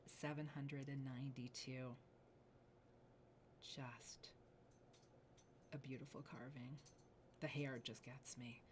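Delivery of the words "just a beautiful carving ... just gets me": soft whispered admiration, lower; awed whisper. Real, not acted. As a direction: let it slip out in a hushed breath. Host awed by jade carving.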